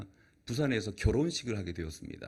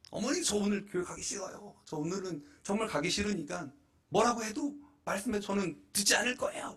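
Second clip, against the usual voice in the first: higher, whiny refusal; sulky son; 8 semitones higher than usual. Retold or imitated. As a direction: whine like a stubborn boy.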